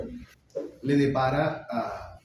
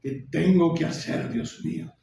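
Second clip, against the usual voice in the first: acted crying, sinking into a voiceless whisper; weeping; 3 semitones higher than usual. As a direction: beg God tearfully, voice collapsing. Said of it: "y llora".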